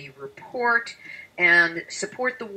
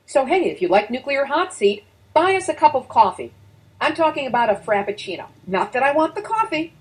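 higher-pitched, lively appeal for donations; listener support pitch; 7 semitones higher, 5 dB louder, faster than usual. Acted. Host asking listeners to chip in coffee money, lively pitch. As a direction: pitch it up, casual and chatty.

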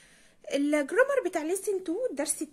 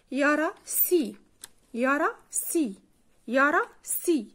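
slow drill; slower than usual. Shown as a demonstration. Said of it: "slow vocabulary drill".

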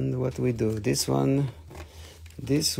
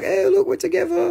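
squeaky, very high cutesy voice; a high falsetto squeal of delight; high crystal voice; 3 dB louder than usual. Pretend. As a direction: play the crystals as tiny happy creatures. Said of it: "They were like".

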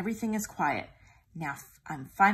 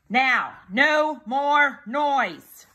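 very slow, louder firm order; stern command; 7 semitones higher, 9 dB louder, much slower than usual. A narrator's reading. Child ordering the tiger firmly, slow and loud. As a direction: lay down the law.

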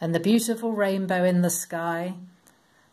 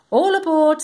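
much higher, louder call; calling all aboard; 10 semitones higher, 8 dB louder than usual. Acted. Noah calling the animals into the ark, loud high call. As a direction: call everyone onto the boat. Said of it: "said Noah".

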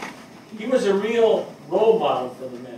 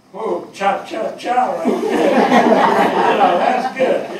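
laughing; 2 semitones higher, 10 dB louder, much slower than usual. Real, not acted. Dancing with her partner.

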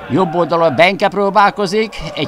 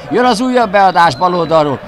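ordinary talk

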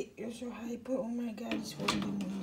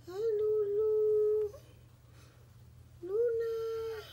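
high, loud, slow singsong greeting; doting pet call; 9 semitones higher, 6 dB louder, slower than usual. Real, not acted. Owner greeting pet princess Luna, high drawn-out doting call. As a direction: draw the pet's name out high.

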